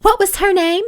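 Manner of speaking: high, probing question; suspicious wondering, pitched well up; searching memory. A narrator's reading. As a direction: reach for a forgotten name. Brooke searching her memory suspiciously.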